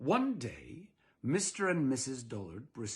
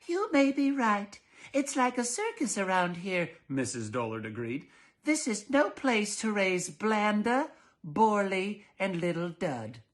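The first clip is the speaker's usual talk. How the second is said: high, slow, fretful wife voice; prim fussy wife; 7 semitones higher, 4 dB louder than usual. Acted. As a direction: fret like an overly prim mother. Missus Dullard agreeing.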